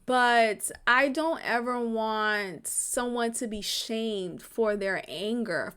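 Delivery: ordinary talk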